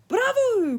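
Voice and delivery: high, loud Italian cheer; delighted cry. A narrator's reading. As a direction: cheer the pupil on, thrilled. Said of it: "cried Signor Alberto".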